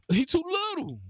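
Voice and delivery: high, sing-song jeer at the defender; mocking taunt. Real, not acted. Gamer mocking the little defender guarding him, high and teasing.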